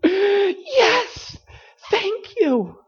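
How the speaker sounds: high, excited cries of yes; thrilled. Retold or imitated. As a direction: burst out with joyful thanks. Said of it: "that you're just like".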